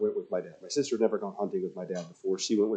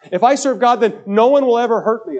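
much higher and louder, whiny mock believer; mock the people who bargain with God; mock naive believer; 13 semitones higher, 11 dB louder than usual.

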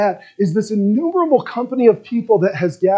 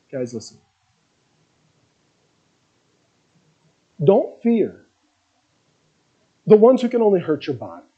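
calm reassurance. Acted. Jesus calming his frightened followers, hushed and gentle. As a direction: settle frightened friends gently.

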